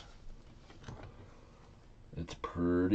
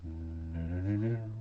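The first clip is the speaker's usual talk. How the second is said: usual speaking voice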